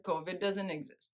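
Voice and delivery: low, soft, unruffled delivery; very calm. Retold or imitated. Retelling a man's calm statement on national TV, very calm. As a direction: quote it calmly and quietly, dropping the pitch. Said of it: "Very calmly".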